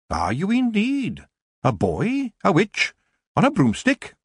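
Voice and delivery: high, swooping questions; incredulous witch shopkeeper. A narrator's reading. Shopkeeper questions the idea of a boy witch, incredulous. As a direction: query each bit with rising surprise. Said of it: "said Missus Proust".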